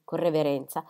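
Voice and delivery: usual speaking voice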